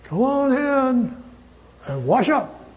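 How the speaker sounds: high, drawn-out call to come in; mother calling. Retold or imitated. Preacher imitates Mother calling children to supper, calling out. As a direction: sing out the call, stretching the first words. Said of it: "and she'd say".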